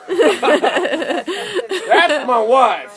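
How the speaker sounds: high, loud and very drawn out, with a laugh; mock indignant husband.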